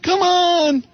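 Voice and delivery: high, incredulous stand-up rant; Seinfeld impression. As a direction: rant about popcorn like Seinfeld. Seinfeld-style bit about popcorn.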